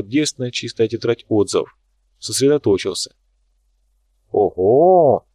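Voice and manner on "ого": loud impressed "Ого!"; a loud appreciative wow; impressed exclamation. A narrator's reading. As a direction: sound genuinely impressed. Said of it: "оценил через несколько минут Лунин".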